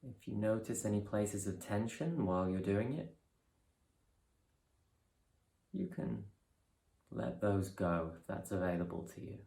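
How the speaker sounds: calm, measured guidance with long pauses; guided meditation. Real, not acted. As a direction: ease them through each breath, unhurried and softening. Leads a breathing meditation.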